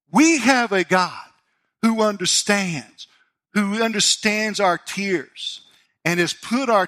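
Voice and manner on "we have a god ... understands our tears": slow, loud preaching, leaning on who; slow emphatic. Real, not acted. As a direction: hammer each who understands home. Preacher stresses God's understanding.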